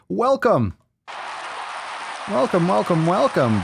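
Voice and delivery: high, excited greeting call; excited welcome. Real, not acted. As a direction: sing out the welcome. Host greeting viewers loudly.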